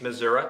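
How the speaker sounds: "Missouri" ending in "uh"; local Missouri pronunciation. Real, not acted. Native repeating where they are from, "Missouri" said with "uh" ending. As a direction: say "Missouri" as Mizzuruh. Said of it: "I can't say I".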